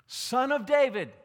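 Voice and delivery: loud desperate call; crying out. Retold or imitated. Blind beggar calls out loudly to Jesus. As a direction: call out over the crowd. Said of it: "he cries out".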